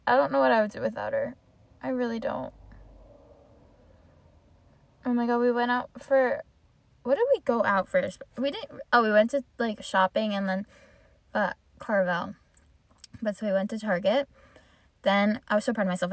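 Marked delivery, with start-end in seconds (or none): none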